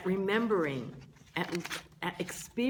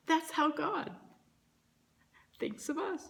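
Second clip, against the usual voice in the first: emotional; 7 semitones higher than usual. Real, not acted. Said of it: "I'm, uh, getting emotional about it".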